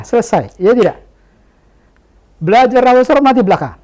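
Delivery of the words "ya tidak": usual speaking voice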